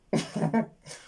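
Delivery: high suppressed giggle; stifled giggle. Real, not acted. Trying not to laugh at the movie accents, giggling. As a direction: giggle you can't hold back. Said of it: "I don't want to laugh".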